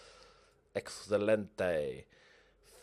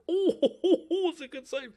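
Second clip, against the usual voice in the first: laughing; 6 dB louder than usual. Real, not acted. Laughs at a save.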